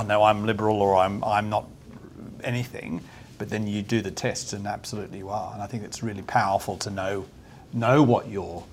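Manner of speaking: ordinary delivery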